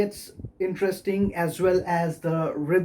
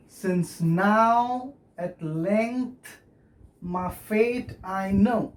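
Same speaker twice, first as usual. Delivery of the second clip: slow, foot-by-foot verse reading; measured recitation with pauses between feet; scanned verse; much slower than usual. Shown as a demonstration. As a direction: draw out the line to show its metre. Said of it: "how does it sound?".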